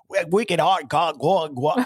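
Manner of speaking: put-on Boston accent. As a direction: do a hammed-up Boston voice. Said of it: "Boston is like".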